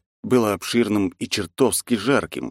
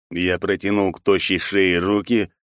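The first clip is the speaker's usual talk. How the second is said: plain reading voice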